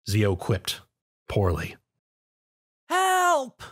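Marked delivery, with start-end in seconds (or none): 2.9-3.6 high, far-off call for help; Spike's cry for help. Acted. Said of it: "the voice of Spike echoed out".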